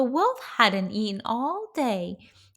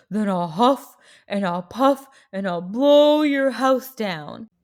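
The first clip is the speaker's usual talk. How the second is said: loud, menacing wolf voice; big bad wolf; 7 dB louder than usual. Wolf threatens the pigs through the door, loud wolf voice.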